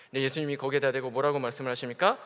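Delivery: ordinary talk, nothing marked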